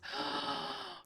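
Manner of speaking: soft, high, breathy gasp of delight; a little delighted intake of breath; sharp happy gasp. Real, not acted. Notices banner feature, gasps.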